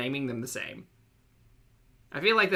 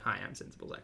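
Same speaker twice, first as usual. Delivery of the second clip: whispered, lower, quiet introduction; 7 semitones lower, 11 dB quieter, much faster than usual. Pretend. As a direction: whisper it like a sober alter ego.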